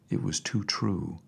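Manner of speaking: ordinary delivery, nothing marked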